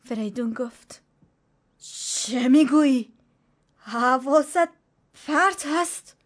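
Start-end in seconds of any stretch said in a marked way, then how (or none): 1.8-6.2 louder, slow, shaken disbelief; trembling voice. A narrator's reading. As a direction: voice shaking as he challenges her. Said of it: "با صدای لرزان گفت".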